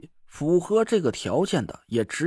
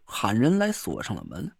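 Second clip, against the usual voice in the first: usual speaking voice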